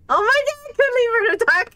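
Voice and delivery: high, fast rush through laughter; blurting it out mid-laugh; laughing hard. Real, not acted. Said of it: "I'm, I'm tearing up".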